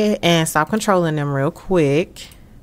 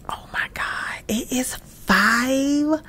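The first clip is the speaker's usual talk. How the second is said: hushed, slow whisper of disbelief; drawn-out stunned whisper; 3 semitones higher, 5 dB quieter, slower than usual. Real, not acted. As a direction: whisper it like it's too late to be awake. Shocked at the late hour.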